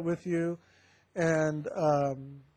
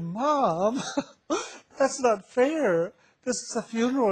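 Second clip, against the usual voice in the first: laughing protest; 6 semitones higher than usual. Retold or imitated. Protests to dying mother, laughing.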